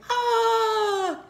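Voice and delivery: high, loud mock cry; snake cry. Snake cries out playfully, high. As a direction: yelp it high.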